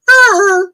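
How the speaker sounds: sudden high, loud cat cry; cat yowl. Retold or imitated. Cat asking for something opened, loud high yowl. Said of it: "she goes".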